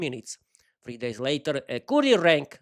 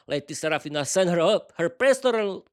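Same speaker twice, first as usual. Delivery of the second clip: ordinary delivery, nothing marked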